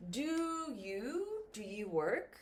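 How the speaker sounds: "work?" rises at the end; rising intonation. Shown as a demonstration. Teacher showing question tune to learners, rising. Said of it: "the intonation goes up".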